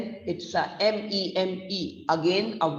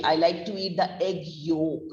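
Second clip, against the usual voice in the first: ordinary talk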